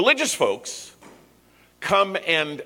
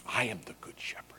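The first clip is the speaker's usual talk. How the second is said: "I am the good shepherd" breathed as an unvoiced whisper, much softer; 7 semitones lower, 13 dB quieter, faster than usual. Shown as a demonstration. Pastor repeats Jesus' words to the congregation as a hushed whisper. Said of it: "is the statement".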